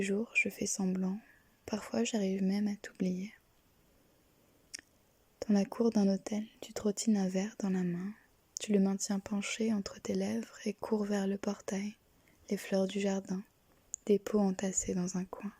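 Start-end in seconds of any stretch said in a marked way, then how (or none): none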